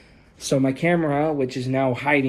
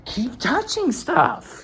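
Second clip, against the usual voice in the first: high scolding; 11 semitones higher than usual. Retold or imitated. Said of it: "I'm like".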